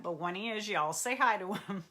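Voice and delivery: ordinary talk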